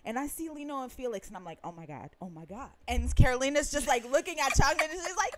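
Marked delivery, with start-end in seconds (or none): 1.6-2.7 retold hushed, low gasp of disbelief; hushed awe. Fan retelling seeing [name] and [name] up close, hushed awe. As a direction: murmur it low and soft, awestruck.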